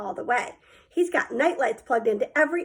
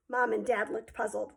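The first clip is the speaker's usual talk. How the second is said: ordinary delivery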